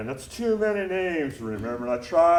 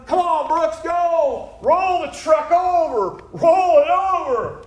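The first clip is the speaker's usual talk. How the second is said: high, loud egging-on shouts; rowdy screamed urging; wild yelling; 14 semitones higher, 6 dB louder than usual. Acted. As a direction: whoop and cheer the driver on at full voice. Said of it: "we'd be laughing and yelling".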